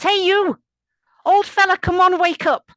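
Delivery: very high, loud irritated shouting; annoyed. A narrator's reading. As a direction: holler to wake a sleeper, annoyed. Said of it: "sounds really quite annoyed".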